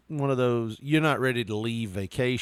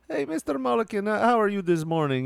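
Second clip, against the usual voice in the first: much higher, sing-song customer-service voice; chirpy hotel manager; 9 semitones higher than usual. Retold or imitated. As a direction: imitate a sugary sales manager greeting a guest. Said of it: "That's the way she talked".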